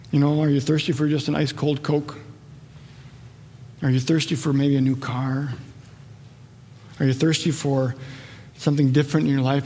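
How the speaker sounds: low, quiet, searching questions; soft reflective appeal. Real, not acted. Preacher asking listeners what they thirst for, hushed and reflective. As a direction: ask gently, leaving long pauses.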